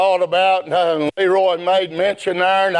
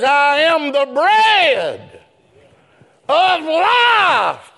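building preaching; 13 semitones higher, slower than usual. Acted. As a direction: climb to a full-throated preacher's cry. Builds sermon to shouted peak.

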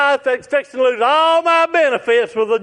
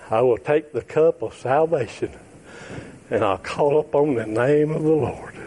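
quiet prayer; 8 semitones lower, 4 dB quieter than usual. Acted. Preacher quietly inviting the lost to give their hearts, hushed. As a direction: draw them in gently with long pauses.

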